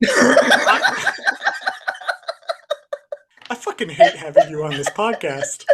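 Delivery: helpless laughter through the words; mock hatred breaking up with laughter; laughing. Real, not acted. Host cracking up at much younger co-host, laughing.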